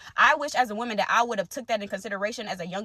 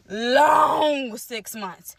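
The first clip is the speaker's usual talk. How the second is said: "long six months" stretched very slowly with a crack in the voice; tearful drawn-out repeat; 4 dB louder, much slower than usual. Real, not acted. Recalling the hard months to viewers, slow and tearful. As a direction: hold back tears as you drag it out. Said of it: "So it was a long six months".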